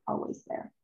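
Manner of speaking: voiceless soft echo; whispered echo. Acted. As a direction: breathe it back like an afterthought. Echo after Tilda's line.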